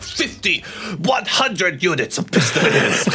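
hammed-up boss voice. Boss voiced in retold scene.

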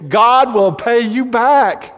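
preacher pressing the point on his congregation; preaching. Acted. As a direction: drive home the promise from the pulpit.

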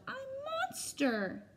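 very high squeak; squeaky mouse. Acted. Mouse pretending to be Monster, squeaking high. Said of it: "squeaks Mouse".

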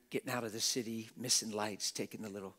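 ordinary talk

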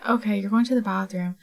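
ordinary delivery

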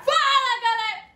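loud, bright vlog greeting; shouted greeting. Real, not acted. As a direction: shout it to the viewers. Opening shout to viewers.

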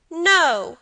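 put-on high, loud childish protest; resolute cry. A narrator's reading. Boy contradicts his grandfather, resolute cry. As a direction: cry out like a stubborn small boy. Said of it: "cried the boy resolutely".

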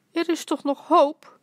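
hoog en luider gepiept; piepend. A narrator's reading. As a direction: piep het angstig hoopvol uit. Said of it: "piepte tante Yvonne". (hoog piepende tante)

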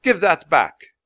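loud, indignant guard's demand; angry yell. A narrator's reading. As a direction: shout the order in outrage. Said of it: "the guard yelled".

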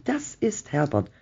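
put-on understated shriek of the old wife; narrated cry without raising the volume; a mild, held-back shriek. A narrator's reading. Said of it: "kreischte sie".

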